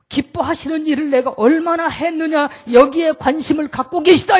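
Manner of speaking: from measured sermon to loud proclamation; building preaching. Acted. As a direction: build the sermon to a ringing peak. Preacher rising to full force.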